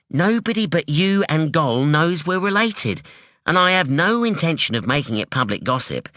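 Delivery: a sharp, wary daughter; hint of a guarded young woman; young woman Anyi. Anyi voiced in story.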